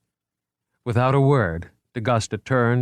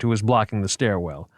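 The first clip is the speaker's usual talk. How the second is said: ordinary delivery, nothing marked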